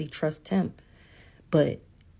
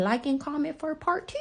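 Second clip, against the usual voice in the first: mock engagement plea; 7 semitones higher than usual. Creator parodying requests for likes, high singsong plea. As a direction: stretch it out in a high mocking singsong. Mocking social media part-two request.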